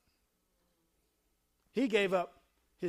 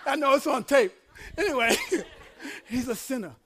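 cracking up while talking; laughing; 4 semitones higher, faster than usual. Real, not acted. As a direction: talk through helpless laughter. Cracks up at own joke on tape.

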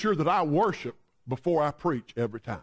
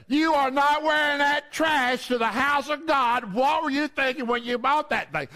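scolding mother; 6 semitones higher than usual. Acted. Mother forbidding the dress for church, hammed-up scolding. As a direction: act out a mother laying down the law.

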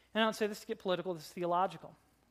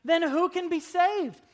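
higher, louder, slowed astonished question; disbelieving cry of the disciples; astonished disciples asking who can be saved, pitched up; 9 semitones higher, 8 dB louder, slower than usual. Acted. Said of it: "utterly astonished and asked".

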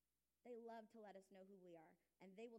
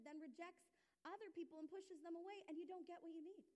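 higher, insistent preacher's build; fervent preaching; 6 semitones higher, 4 dB louder than usual. Acted. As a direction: press the point with fervour. Preaching with rising fervour.

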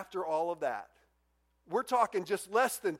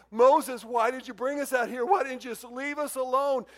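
higher, exasperated protest, first word hit loud; whiny complaining; 6 semitones higher, 5 dB louder than usual. Acted. Israelites blaming Moses for bringing them out, complaining. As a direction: complain at your leader, pitched up. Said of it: "that's how it comes across to me".

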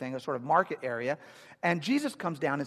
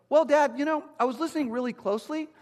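put-on much higher, innocent kid voice; child voice; 7 semitones higher, 5 dB louder than usual. Seven-year-old son Jameson answers his dad, high innocent kid voice.

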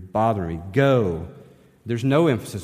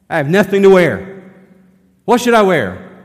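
whiny complaint; 9 semitones higher, 12 dB louder than usual. Acted. Mocking a panicked closet complaint.

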